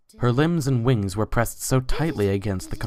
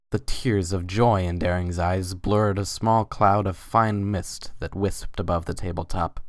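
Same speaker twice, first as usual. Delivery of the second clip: plain reading voice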